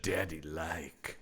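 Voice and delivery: very slow, low and soft; creature's simple speech. Retold or imitated. Mocking the creature's odd line, creature's simple speech. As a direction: drag out the words low and quietly. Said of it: "that he said".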